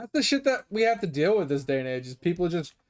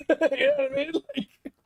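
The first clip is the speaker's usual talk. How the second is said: laughing; 8 semitones higher than usual. Real, not acted. Laughing at the absurd story.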